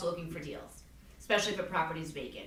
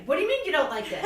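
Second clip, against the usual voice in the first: higher, louder mock outrage; retold angry client; 8 semitones higher, 6 dB louder than usual. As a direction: play the offended seller picking a fight. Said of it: "fight with everybody".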